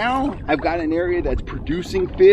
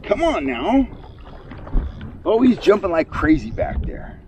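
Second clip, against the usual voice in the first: fighting a fish; 6 semitones higher than usual. Real, not acted.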